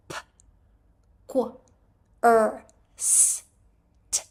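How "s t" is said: usual speaking voice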